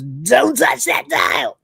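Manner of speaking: high, slow shouted command; hammed-up virus shouting at Lister; mock shout. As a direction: bark it out like a DJ with attitude.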